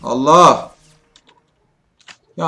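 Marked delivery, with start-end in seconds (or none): 0.0-0.8 startled exclamation. Real, not acted. Sudden death in game.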